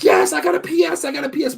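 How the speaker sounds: high, loud thrilled yell; excited kid shout. Retold or imitated. Retold kid on Christmas Eve.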